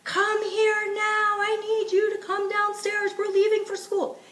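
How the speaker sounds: the nagging parent calling upstairs; mock screaming parent. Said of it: "no screaming".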